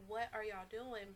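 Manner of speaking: ordinary delivery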